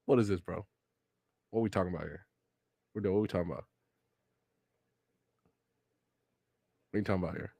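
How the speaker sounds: softly baffled questions; quiet bafflement. Real, not acted. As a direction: mutter in confusion. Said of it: "baffled after laughing".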